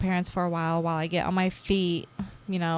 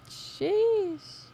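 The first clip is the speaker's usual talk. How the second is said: weary sigh; 12 semitones higher, 4 dB quieter than usual. Real, not acted. After embarrassing memory.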